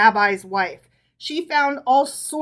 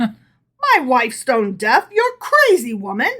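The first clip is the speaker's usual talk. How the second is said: furious disbelief; enraged; 6 dB louder, slower than usual. A narrator's reading. Rabbi to Herschel's wife, enraged cry. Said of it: "beside himself with rage".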